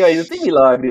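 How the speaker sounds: usual speaking voice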